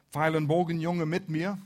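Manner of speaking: ordinary talk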